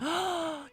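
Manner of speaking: high, theatrical gasp; mock shocked gasp. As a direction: gasp in pretend horror. Mock shock at rule breaking.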